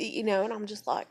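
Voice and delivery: ordinary talk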